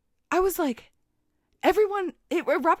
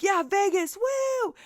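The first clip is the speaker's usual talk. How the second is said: higher whooping put-on voice; cheering drunk; 10 semitones higher, much slower than usual. Drunk Davis cheering for Vegas in a high whooping voice. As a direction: whoop it like a party guy.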